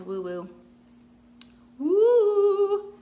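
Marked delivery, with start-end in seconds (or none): 1.8-2.9 high loud mock woo; a big spooky woo-woo hoot. Painter teasing her own woo-woo advice, loud hooting woo.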